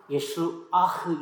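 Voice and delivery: "예수아흐"의 뒤쪽에 액센트; 끝에 '흐' 소리를 살려 "예수아흐"; 히브리식 발음. Shown as a demonstration. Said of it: "원래 히브리식 발음". ("예수아흐", 히브리어처럼 뒤를 강조)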